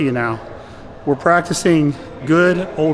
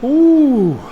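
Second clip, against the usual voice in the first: high, loud groan of relief; exhausted groan; 10 semitones higher, 6 dB louder than usual. Real, not acted. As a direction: let out a spent groan after the set. Groan right after final set.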